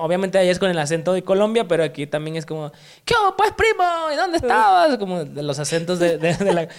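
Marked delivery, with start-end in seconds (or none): 3.1-5.0 much higher, sing-song Mexican coastal accent. Shown as a demonstration. Host imitating Mexican coast speech for the guest, high playful costeño. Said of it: "el costeño también aquí".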